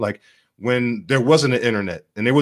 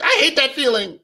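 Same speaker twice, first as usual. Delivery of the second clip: much higher, whiny mock voice; hammed-up high whimper; mocking whiny beta male; 13 semitones higher than usual. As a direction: sneer it like a scared man wanting his safe space. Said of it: "You're like".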